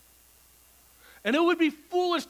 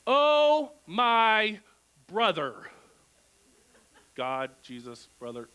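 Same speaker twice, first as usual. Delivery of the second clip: James's exasperated sigh; 6 semitones lower than usual. Retold or imitated. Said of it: "And James would be like".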